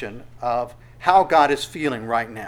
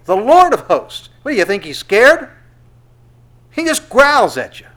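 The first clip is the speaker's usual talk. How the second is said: loud and high, almost shouting; fervent sermon peak, near shouting; preaching climax; 9 semitones higher, 11 dB louder than usual. Acted. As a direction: thunder it out at full force. Preacher climaxing on God's power.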